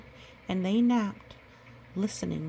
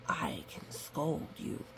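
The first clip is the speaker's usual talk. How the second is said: soft slow whisper to the pup; 5 semitones lower, 7 dB quieter than usual. Acted. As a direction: hush it, slow and breathy-free whisper. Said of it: "unvoiced whisper".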